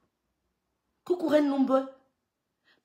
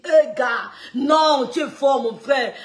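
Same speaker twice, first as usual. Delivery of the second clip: excited; 8 dB louder, slower than usual. Retold or imitated. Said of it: "tu dis".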